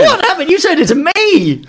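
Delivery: squeaky high mimic of a cracked voice; mock voice crack. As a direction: jump way up high, teasing. Mocking the voice break.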